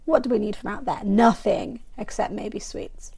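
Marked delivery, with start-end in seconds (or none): 2.0-3.1 unvoiced whisper, much softer; sneaky whispered confession; whispered aside. Real, not acted. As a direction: breathe it like a secret. Whispered aside about sweets.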